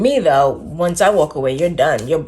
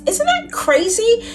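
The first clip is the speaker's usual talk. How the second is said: choked disbelief; 12 semitones higher, slower than usual. Real, not acted. Emotional disbelief.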